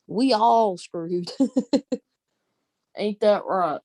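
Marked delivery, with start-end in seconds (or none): none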